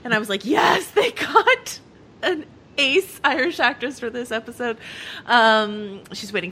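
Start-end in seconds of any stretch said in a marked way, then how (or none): 0.4-4.8 thrilled cheer. Retold or imitated. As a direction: stretch it out high in delight. Retells delight at the casting.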